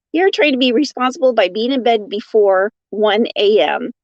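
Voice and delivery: usual speaking voice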